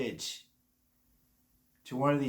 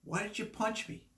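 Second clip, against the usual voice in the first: higher, softer mock victim voice; imitated false accuser; 7 semitones higher, 8 dB quieter than usual. Attacker blaming the one they hurt, mock victim. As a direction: play the wronged victim in a high, soft voice. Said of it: "and they'll say".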